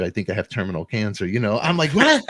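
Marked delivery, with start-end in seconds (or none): 1.9-2.2 a much higher, louder stunned "what"; shocked what. Retold or imitated. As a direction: yelp it in disbelief. Retold shocked reaction.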